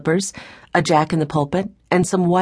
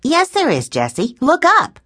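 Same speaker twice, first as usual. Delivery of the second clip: put-on high-pitched, excited storybook girl; 10 semitones higher, 4 dB louder than usual. A narrator's reading. Violet correcting Jessie in the woodshed, excited. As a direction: point out the find, voice leaping up. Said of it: "Violet was excited".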